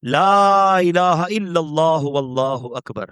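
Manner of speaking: slow drawn-out takbir; spontaneous takbir. Acted. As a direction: let the praise pour out slowly. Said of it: "بے ساختہ آپ کی زبان سے نکلا". (بے ساختہ آہستہ تکبیر)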